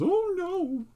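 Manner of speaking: a very high, loud, squealed oh no; shrieking dismay. Acted. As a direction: shriek in comic horror. Looks at narrator, dismayed.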